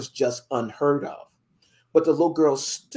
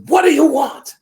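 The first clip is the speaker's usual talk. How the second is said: put-on sudden loud high bark at the child; angry landowner; 12 semitones higher, 12 dB louder than usual. Landowner barking at the little girl, angry and loud. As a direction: make him snap at the intruder.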